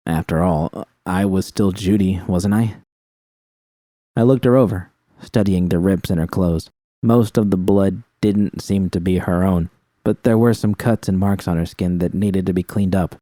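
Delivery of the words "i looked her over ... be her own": plain reading voice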